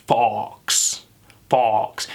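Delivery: open, drawn-out British vowel in FOX; British accent. Shown as a demonstration. Accent teacher showing the word fox, British accent. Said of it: "in a British accent".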